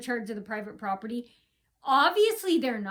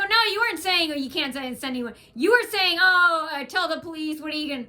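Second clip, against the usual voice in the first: loud, high angry retort; heated rebuttal; 4 semitones higher, 6 dB louder than usual. Retold or imitated. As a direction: throw his words back at him. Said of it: "I go".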